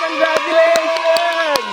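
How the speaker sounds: very slow, stretched congratulations; drawn-out congratulations. Pretend. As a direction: stretch the word out grandly.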